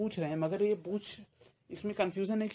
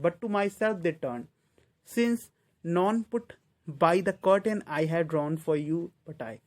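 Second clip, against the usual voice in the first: slow, emphatic verse reading; deliberate declaimed poem lines; poem recitation; 5 dB louder, much slower than usual. Acted. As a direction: recite slowly and a little louder, phrase by phrase.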